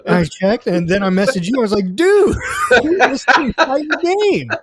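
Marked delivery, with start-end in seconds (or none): 2.0-4.5 laughing disbelief. Retold or imitated. Retelling message to cohost.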